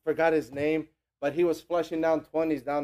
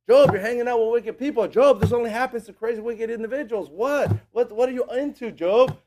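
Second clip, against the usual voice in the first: higher-pitched, loud imitated badgering; annoying friends nagging; 6 semitones higher, 6 dB louder than usual. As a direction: pester Job with mock accusations. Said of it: "what they're really doing is annoying him".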